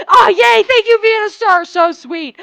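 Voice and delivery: high, loud burst of joy; delighted thanks. Real, not acted. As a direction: squeal your thanks, then ease a little.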